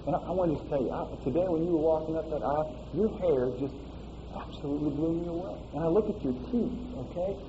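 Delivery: soft, tender words to his bride; tender husband. Pretend. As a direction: speak softly like a groom on his wedding night. Groom speaking to bride, wedding night.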